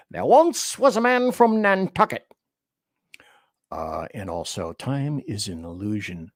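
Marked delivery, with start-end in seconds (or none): 0.1-2.3 high, put-on reciting voice; limerick recital. Host teasing chat with a limerick, high mock recital.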